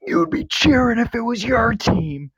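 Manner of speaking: high, slow mocking taunt in his face. Taunting a rival fan to his face, mocking. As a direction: jeer it right in his ear.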